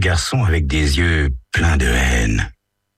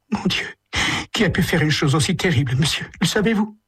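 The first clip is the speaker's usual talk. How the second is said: higher, hurried grieving voice; mother of dead boy asking the detective, higher, anguished; distraught mother; 13 semitones higher, faster than usual. Acted. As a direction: plead for an answer.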